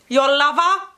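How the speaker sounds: loud, coy tease; cheeky suggestive question. Real, not acted. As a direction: wink it at the viewer. Teasing suggestive word.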